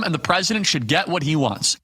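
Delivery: ordinary talk, nothing marked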